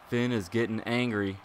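normal talking voice

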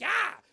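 high, loud ah; mock scream of alarm; startled yelp. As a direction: cry out as if spooked. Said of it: "I'm like".

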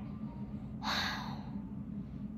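long, breathy unvoiced sigh; deflated fairy sigh; heavy sigh. A narrator's reading. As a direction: let all the air out in defeat. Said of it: "and sighed".

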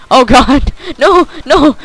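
loud, high panicked shouts; panicked yelling. Real, not acted. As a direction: yell in real panic as you get mugged. Panicking while being robbed in game.

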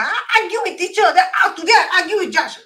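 high, loud, quick laughing outburst; mock argumentative. Fool boasting of arguing with teacher and judge, mock argumentative. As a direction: blurt it like a hot-headed fool.

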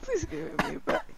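high, whiny fake sob through the words; high whimpering plea, faked; fake crying. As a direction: put on a pleading cry to get the items back. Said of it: "Are you crying?".

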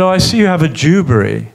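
"Dewberry" said as if it were the name, lightly imitating the lodger; casual "Dewberry" in a light imitation of the lodger, fast; lodger's Dewberry. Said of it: "you what?".

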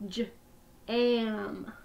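each sound said separately with a short break; sounds tapped apart. Shown as a demonstration. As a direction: split the word into its sounds, one by one. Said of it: "Let's do it again".

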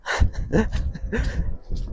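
breathy, mostly voiceless chuckle; high-pitched soft laugh. Real, not acted. Owner amused by his runaway horse, chuckling.

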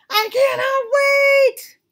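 high, loud, slow crying wail; impatient wail. Acted. Gerald cannot bear waiting, high loud wail. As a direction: wail it like a desperate child.

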